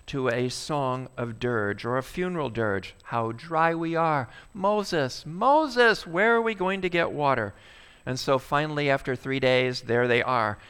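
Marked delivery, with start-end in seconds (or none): 3.0-7.5 rising plaintive cry of Moses, Moses; whining Israelites. Acted. Thirsty people whining up at Moses.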